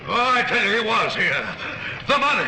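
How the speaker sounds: desperate insistence. Acted. Cornered banker insists desperately.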